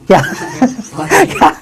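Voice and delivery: amused, laughing question. Real, not acted. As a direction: break into laughter, then ask in a squeak. Laughs at the misreading.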